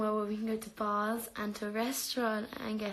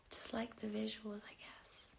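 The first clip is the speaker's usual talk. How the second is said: whispered shy answer; 12 dB quieter than usual. Real, not acted. Answering a viewer in a whisper. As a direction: breathe it out almost silent.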